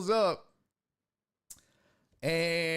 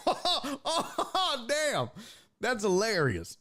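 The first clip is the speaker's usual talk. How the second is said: laughing; 7 semitones higher, slower than usual. Real, not acted. Laughing at the cop's story.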